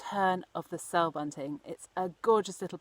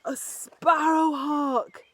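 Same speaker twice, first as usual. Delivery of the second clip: higher, louder, slow horrified cry; terrified cry; 8 semitones higher, 6 dB louder, much slower than usual. Acted. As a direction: recoil at the hawk. Cyril terrified of hawk.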